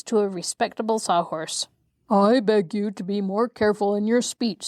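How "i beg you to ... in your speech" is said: crisp reproach in an odd character voice; a sharp, prim warning; sharp rebuke. A narrator's reading. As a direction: scold the horse sharply. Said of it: "said the Pumpkinhead sharply".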